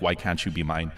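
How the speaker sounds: ordinary talk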